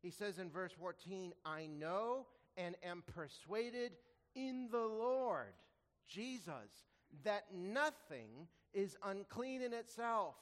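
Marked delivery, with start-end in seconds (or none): 1.4-10.4 slow, weighty, each phrase leaned on and lifted; slow emphatic scripture reading. Acted. Preacher reading Paul's words to the congregation, slow and emphatic. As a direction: read Paul's words slowly, as if proclaiming them.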